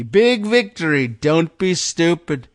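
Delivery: hammed-up victory boast; mock rally shout. Host reading Trump's post, hammed-up shout. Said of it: "Both capitalized there".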